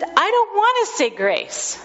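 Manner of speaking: put-on high little-boy refusal; a troubled toddler pushing back; high child voice, firm and upset.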